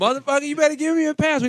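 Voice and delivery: loud, high threatening robber; mock robber threat. Comic retelling the robbery bit, loud high threatening robber. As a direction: threaten him like a mugger. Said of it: "He goes".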